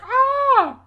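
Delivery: very high, loud, sharp scream; piercing scream. Retold or imitated. Showing how the scream from the hall sounded, very high and loud. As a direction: let out a sudden shrill shriek. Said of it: "It screams like".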